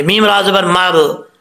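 normal talking voice

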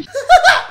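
high, loud gloating cackle; mocking laugh. Real, not acted. Prankster hearing threats to report him, mocking laugh. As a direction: burst out laughing at their outrage. Troll laughs during fight.

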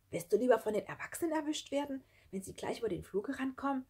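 high, urgent warning question; insistent. A narrator's reading. Schlunz warns Sarah about the adults, insistent urging. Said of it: "fragte Schlunz eindringlich".

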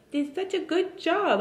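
very high sing-song coo; baby talk. Acted. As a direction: praise the baby in a cooing voice. Repeated praise to baby.

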